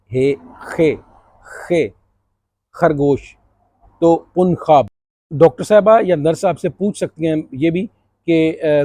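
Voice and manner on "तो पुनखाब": पुनखाब with a harsh throaty kh sound; Spanish j as kh. Shown as a demonstration. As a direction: say पुनखाब, j turned into kh. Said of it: "इसको हम कहते हैं खोता".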